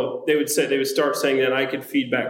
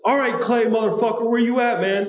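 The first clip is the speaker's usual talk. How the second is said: mock callout; 7 semitones higher than usual. [name] bursts in calling Clay out.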